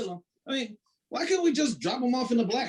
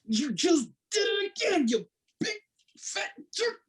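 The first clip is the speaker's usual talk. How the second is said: whiny crying; 9 semitones higher than usual. Acted. Pilot punched, crying out.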